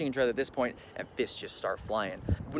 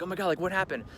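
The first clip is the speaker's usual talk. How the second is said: higher-pitched retold astonishment; imitated alarm; 7 semitones higher than usual. Colleagues running over after fight, imitated alarm. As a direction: pitch up as a startled onlooker.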